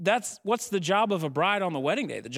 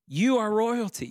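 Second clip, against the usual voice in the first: higher, ringing preacher's call; proclaimed declaration; 7 semitones higher than usual. Acted. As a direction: declare it over the whole room.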